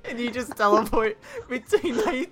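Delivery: giggling while talking; words broken by laughter; laughing through words. Real, not acted. Giggling through silly scenario.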